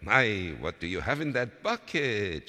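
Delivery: high, singing polite greeting; neighbourly hello, starting high and loud; polite neighbour lady. A narrator's reading. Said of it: "she said politely".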